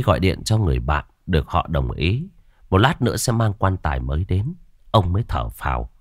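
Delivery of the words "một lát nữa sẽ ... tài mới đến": usual speaking voice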